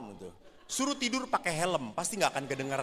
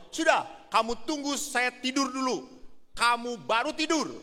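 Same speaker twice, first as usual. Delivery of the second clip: higher, bossy wife voice; imitating the wife; 7 semitones higher than usual. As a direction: order him around like a nagging wife. Wife scolding husband. (istri menyuruh suami menunggu, suara tinggi)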